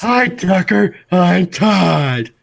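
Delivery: high, loud goofy voice; squeaky, loud cartoon character; silly cartoon voice. Silly greeting bit.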